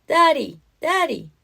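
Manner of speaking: put-on high excited toddler squeals; squealing. A narrator's reading.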